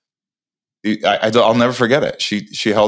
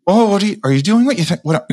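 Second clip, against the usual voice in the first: surprised; 11 semitones higher, faster than usual. Retold or imitated. Startled girlfriend reacting to proposal.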